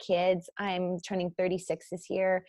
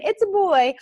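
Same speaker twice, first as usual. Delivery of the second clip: mock yell; 12 semitones higher, 9 dB louder than usual. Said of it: "Not even".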